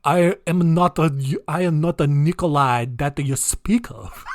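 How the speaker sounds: comic mock Chinese accent with Russian underneath; Russian-Chinese hybrid accent. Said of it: "in that weird, like, hybrid accent".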